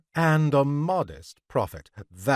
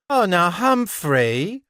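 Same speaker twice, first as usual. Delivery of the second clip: high, drawn-out, loud coaxing; pleading woman; 8 semitones higher, 5 dB louder than usual. A narrator's reading. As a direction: plead with your man in a raised voice. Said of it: "the woman pleaded".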